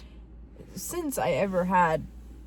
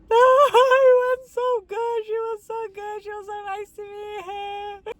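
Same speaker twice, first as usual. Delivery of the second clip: much higher, teary excited gush; emotional gushing; 11 semitones higher, 6 dB louder than usual. Retold or imitated. To her boyfriend after the visit, teary high gush. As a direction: squeal out the relief, almost crying.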